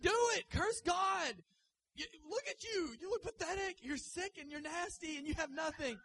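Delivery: high, loud hammed-up yelling; mocking shrill wife. Job's wife berating her husband, hammed-up yell. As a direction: berate him in a shrill mock fury. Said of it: "she's like".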